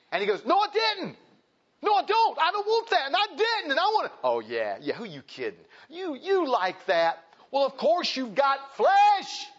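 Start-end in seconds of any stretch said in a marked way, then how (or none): 0.4-4.2 high-pitched acted protest; besieged man's raised-pitch objections; frantic denial. Accused man protesting to enemy. 4.2-5.6 low, knowing taunt; tempter's dismissive drop in pitch; scoffing enemy. Retold or imitated. Enemy mocking the denial. 5.9-9.5 high, slow, drawn-out accusation; acted accuser bearing down loudly; taunting enemy.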